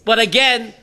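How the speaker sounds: ordinary talk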